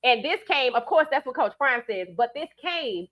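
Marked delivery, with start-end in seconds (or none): none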